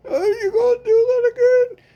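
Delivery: hammed-up sobbing through the pain; mock sobbing wail. Victim begging friend to stop the woman, fake sobbing.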